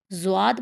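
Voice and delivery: زواد with a z and a w glide; wrong zwaad. Shown as a demonstration. Teacher warns of a common mistake, زواد with a w glide. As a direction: round the lips into "zwaad" for زواد. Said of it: "تو یہ بالکل غلط ہے".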